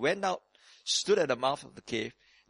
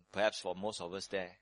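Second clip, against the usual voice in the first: ordinary delivery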